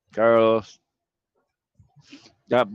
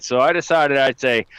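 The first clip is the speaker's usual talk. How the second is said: ordinary talk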